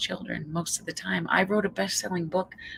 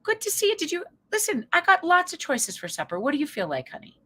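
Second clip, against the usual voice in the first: gentle honey; 14 semitones higher than usual. Retold or imitated. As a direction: coax her gently and kindly. So I'm gonna say.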